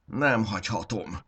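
Nem hagyhatom groaned out, strained; groaning. Acted. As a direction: groan the words as if in pain. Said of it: "nyögte".

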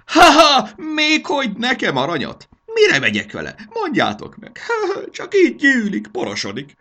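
very high, loud scoffing with acted ha-has; hammed-up mirth at an absurd idea; laughing gnome. A narrator's reading. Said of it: "Fabi úgy nevetett". (harsányan kacagó, magas manóhang)

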